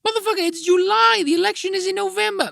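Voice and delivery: high, loud, fed-up yell; exasperated outburst. Real, not acted. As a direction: blow up at the absurdity, voice shooting up high. Exasperated outburst at co-hosts' claim.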